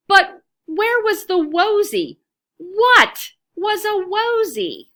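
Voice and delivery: usual speaking voice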